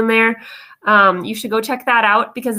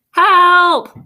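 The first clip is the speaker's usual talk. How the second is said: higher, louder comic plea for help; mock cry for help; 7 semitones higher, 7 dB louder than usual. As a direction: ham up a desperate "Help!" as the tired student. Student's tired voice plea.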